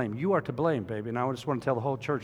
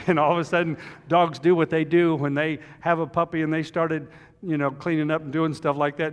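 ordinary delivery

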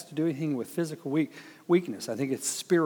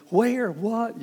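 pitched up, startled where, what; a scared traveller, mock startled, high; high startled panic; 9 semitones higher than usual. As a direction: jump at every word in fright. Frightened woman's reply.

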